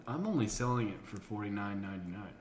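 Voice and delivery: ordinary delivery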